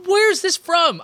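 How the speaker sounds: high disbelieving squeak; incredulous high pitch. Real, not acted. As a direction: rise way up in disbelief. Disbelief at quippy Batman.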